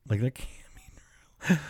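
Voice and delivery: an aside to listeners, a whisper; whispered disbelief. Real, not acted. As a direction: breathe it out in a whisper. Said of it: "whispered aside".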